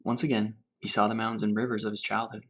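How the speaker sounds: unhurried storybook reading to children, lightly voiced; story reading. A narrator's reading. Teacher reading Grandfather's Journey to her class, gentle storytelling. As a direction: read it gently, letting each image settle. Teacher reads picture book aloud.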